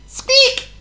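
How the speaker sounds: one very high, loud squeak; mouse squeak. A narrator's reading. John Mouse trying to wake his sleeping owner, loud squeak. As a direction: squeal the word like a tiny mouse. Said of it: "stood by the side of the bed and squeaked".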